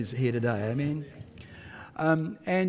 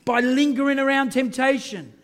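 louder, higher sermon delivery; full-force preaching; 7 semitones higher, 5 dB louder than usual. Acted. Pastor preaching to the church about Joseph, full force. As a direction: preach it out to the congregation. Preaching urges alertness against the devil.

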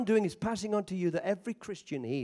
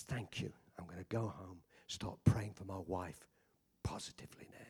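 mild, retold tearful whisper, much softer and lower; 13 semitones lower, 11 dB quieter than usual. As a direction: let it fall to a broken whisper. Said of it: "And he was crying".

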